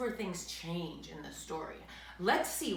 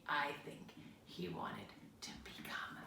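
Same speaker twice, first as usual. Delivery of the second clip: much lower, softer whisper; 3 semitones lower, 11 dB quieter than usual. Real, not acted. Telling children a secret, whispered. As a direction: confide it in a whisper. Hushed aside.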